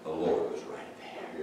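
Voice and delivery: hushed, awed whisper. Acted. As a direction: whisper it in wonder. Hushed awe.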